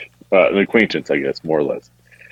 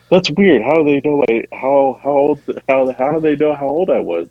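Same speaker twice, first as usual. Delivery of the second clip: retold bafflement; 5 semitones higher than usual. Retells his own alarm.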